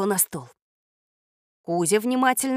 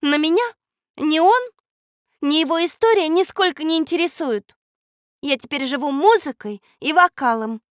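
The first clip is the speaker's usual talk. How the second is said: higher, louder, mildly indignant; cross reply; 7 semitones higher, 5 dB louder than usual. A narrator's reading. Girl says history does not interest her, cross. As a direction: dismiss it with raised, irritated emphasis. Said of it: "сердито ответила Катя".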